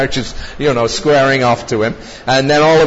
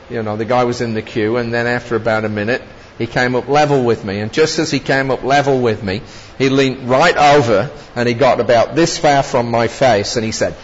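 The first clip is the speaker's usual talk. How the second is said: normal talking voice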